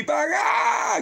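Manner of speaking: high shouted yelp as if struck down; high mock yelp of someone dropping; mock cry of shock. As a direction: yelp out the collapse. Acting out sudden collapse.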